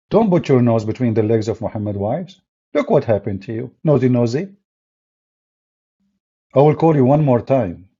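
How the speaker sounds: ordinary talk, nothing marked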